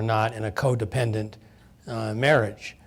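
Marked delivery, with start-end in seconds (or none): none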